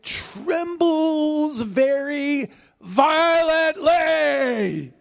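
high, drawn-out preacher's cry; slow, swelling theatrical exclamation; dramatized violent trembling. Retold or imitated.

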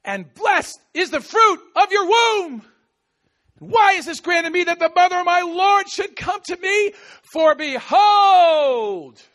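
loud, high proclamation; shouting. Acted. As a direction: cry it out for all to hear. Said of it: "she's shouting this".

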